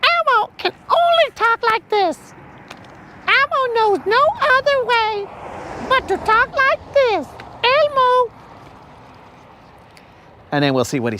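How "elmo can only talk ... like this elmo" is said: very high, squeaky puppet voice, dead set on staying in character; Elmo voice. Pretend. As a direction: keep the Elmo squeak, stubborn and sweet. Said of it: "answer as Elmo".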